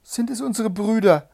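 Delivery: high, loud, eager question; hopeful cry. A narrator's reading. Wife asks Anna about their brothers, hopeful cry. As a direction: ask urgently, voice leaping up.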